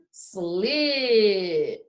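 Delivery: long drawn slip with a late P; say it slowly. Shown as a demonstration. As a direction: stretch slip out before closing the P. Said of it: "gonna say it one more time".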